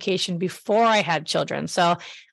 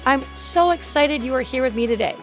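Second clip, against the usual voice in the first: brassy voice; 3 semitones higher than usual. Acted. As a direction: sell it like a radio host. Podcast intro read over music.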